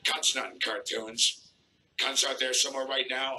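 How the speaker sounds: normal talking voice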